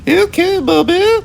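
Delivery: put-on very high squeaky little boy voice; Timmy's voice. A narrator's reading. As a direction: pipe up like a small child. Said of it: "Timmy said".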